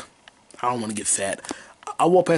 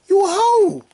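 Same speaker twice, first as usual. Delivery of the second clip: mocking taunt; 5 dB louder, slower than usual. Mocking the husband, pitched up.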